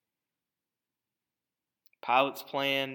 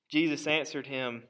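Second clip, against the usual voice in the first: ordinary talk, nothing marked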